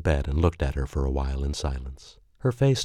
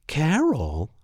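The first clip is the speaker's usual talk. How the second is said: mocking her outrage; 11 semitones higher than usual. Boyfriend mimicking her outraged cry, teasing. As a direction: ham up her shocked tone. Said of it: "I mocked her facial expression and her voice".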